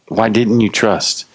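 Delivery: slow, even, gently probing question; non-condemning. Acted. Jesus inviting disciples to look at themselves, non-condemning. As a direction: ask it without blame, unhurried. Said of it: "Jesus wasn't condemning them".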